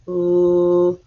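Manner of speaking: "l" as a held L sound; letter sound demo. Shown as a demonstration. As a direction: sustain "l" on one level note. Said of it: "I hear the ul sound".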